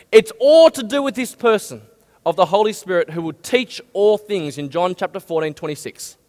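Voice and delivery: ordinary talk, nothing marked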